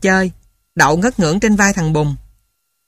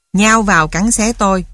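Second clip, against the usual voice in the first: ordinary delivery, nothing marked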